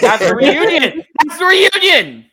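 excited shout. Real, not acted. Excited about tag team reunion.

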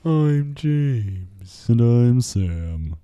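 long drawn-out, glum introduction; sad-sounding. Pretend. Co-host practicing the show intro, sounding sad and drawn-out. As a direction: stretch each word out mournfully. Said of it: "You sound sad".

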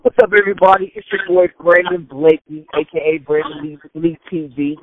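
loud, hyped host opener; podcast intro. Acted. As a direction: pump up the show welcome.

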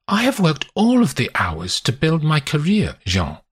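a touch of hurt pride; a lover defending her hard work; Constance, indignant. Acted. Constance voiced by narrator.

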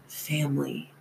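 dropped to a near hush; quiet realization. A narrator's reading. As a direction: murmur the word as it dawns.

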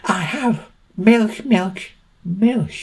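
high, drawn-out conspiratorial squeak; squeaky milk seller confiding, not truly whispered; mock secretive whisper. A narrator's reading. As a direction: share a secret slowly in a very high voice. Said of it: "she whispered".

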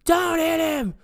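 very high, frantic pleading yell; imitated girlfriend yelling. Girlfriend yelling at her boyfriend mid-fight, high frantic yell. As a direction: yell it high, begging him to stop. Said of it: "she's yelling at him like".

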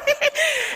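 short bright laugh bursting out high; high burst of laughter. Real, not acted. A woman laughing at how spooky God answers her. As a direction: laugh at your own scare.

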